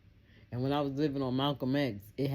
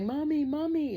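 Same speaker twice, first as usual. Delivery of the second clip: much higher, louder calling; worried neighbor; 5 dB louder than usual. Retold or imitated. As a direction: call after her urgently. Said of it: "they was like".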